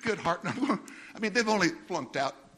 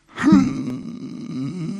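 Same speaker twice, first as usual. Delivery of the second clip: a louder, higher empty hum; zero's hum; 7 semitones higher, 9 dB louder than usual. Pretend. A zero's hum to mock broken promises. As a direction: hum like a zero given a voice. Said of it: "That's the sound of a zero if it could have a voice".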